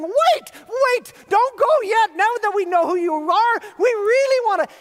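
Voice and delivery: high, loud desperate calling out; shouting up at the ceiling to stop him leaving; frantic screaming. Retold or imitated. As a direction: yell after someone vanishing. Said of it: "screaming at the ceiling".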